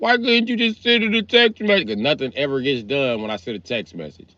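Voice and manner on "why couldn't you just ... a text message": high-pitched imitation of a whining kid; mock complaining daughter. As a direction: mimic a daughter's protest, pitched way up.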